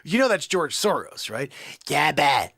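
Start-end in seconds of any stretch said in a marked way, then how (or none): 1.9-2.5 low, loud alien crime-boss voice; Jabba the Hutt. Acted. Comic imitating Jabba for viewers, alien creature voice. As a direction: boom it like a huge creature. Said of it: "Jabba the Hutt".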